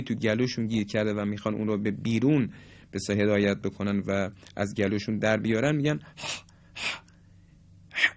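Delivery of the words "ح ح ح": ح as a rasping clearing of the throat; throaty ح. Shown as a demonstration. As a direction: push ح out as if dislodging a seed husk. (ح با گرفتگی گلو)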